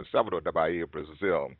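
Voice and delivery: normal talking voice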